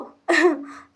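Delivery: bright burst of laughter; quick amused laugh; short laugh. Real, not acted. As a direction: laugh at your own surprise. Laughs after her surprised reply.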